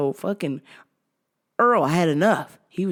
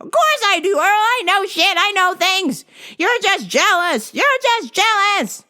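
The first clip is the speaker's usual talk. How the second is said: high, loud stubborn nag; high old wife voice; 6 dB louder than usual. Retold or imitated. Maggie arguing with her husband, high stubborn insisting.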